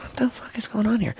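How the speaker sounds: soft, voiceless whisper; puzzled whisper. Retold or imitated. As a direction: whisper it in bafflement. Retells puzzled reaction to hiss.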